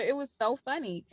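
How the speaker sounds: ordinary delivery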